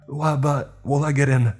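high, frantic worried question; nervous Toby. Acted. Toby frets about getting in trouble. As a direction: stammer in panic.

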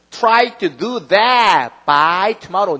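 very slow, deliberate reading; slow demo sentence. Shown as a demonstration. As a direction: spell it out slowly for students. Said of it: "이렇게 해야지". (아주 천천히 또박또박 읽기)

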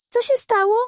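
high, anxious little voice; a concerned puppy asking what's wrong; worried question. Acted. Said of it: "Karmelek zaniepokoił się". (zaniepokojone, wysokie pytanie)

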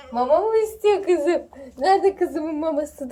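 high cooing pet talk. Real, not acted. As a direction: coo at the cat about food. Cooing to her cat.

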